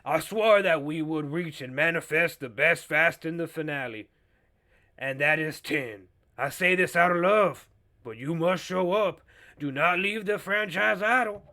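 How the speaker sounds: mock Southern speechifying, emphatic and swinging; Creole Southern man. Host hamming up Vin Diesel's plea, Creole Southern accent. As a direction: declaim it as a Creole Southern gentleman. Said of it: "turning into, like, a, uh, Creole Southern man".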